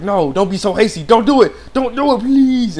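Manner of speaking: loud, high acted plea, stretched at the end; mock urgent begging. Ren begging Yukio not to do it, mock desperate. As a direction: beg with hammed-up urgency.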